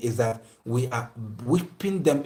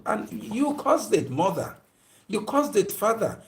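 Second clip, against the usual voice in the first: accusing; 8 semitones higher than usual. Real, not acted. Preacher accusing parents directly.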